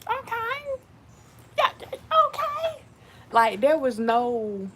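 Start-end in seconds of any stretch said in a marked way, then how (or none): 0.1-2.8 high, slow, drawn-out mock okay; a skeptical yeah okay, pitched way up and slow; ugly face okay. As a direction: stretch each okay with a sour face. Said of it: "The ugly face".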